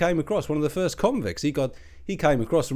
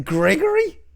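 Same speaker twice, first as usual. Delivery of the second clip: incredulous; 12 semitones higher, 4 dB louder, slower than usual. Acted. Incredulous at the posh name.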